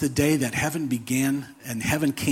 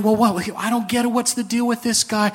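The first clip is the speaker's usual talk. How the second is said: higher, fast, baffled mock angel; arguing angels; 8 semitones higher than usual. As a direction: angels puzzling over this guy among themselves. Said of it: "they might be arguing".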